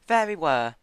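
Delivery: high, gentle pony voice; high timid pony. Acted. Fluttershy voiced.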